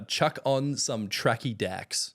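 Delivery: slow, careful Aussie slang read; slow clear demo. Shown as a demonstration. Australian host teaching slang, said slowly. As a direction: spell it out slowly for him. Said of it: "So the next one is".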